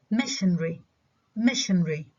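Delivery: missionary squeezed into MISH-un-ree; reduced to three syllables. Shown as a demonstration. Teacher giving an example, missionary reduced to MISH-un-ree. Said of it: "نعمل له reduction ل three syllables".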